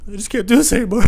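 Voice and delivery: high, loud melodramatic wail; joking despair; mock despair. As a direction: ham up the hopelessness.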